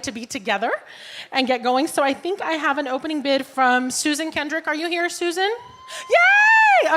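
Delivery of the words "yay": high loud yay; excited cheer. Real, not acted. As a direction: cheer like a fan. Cheering a bidder's arrival.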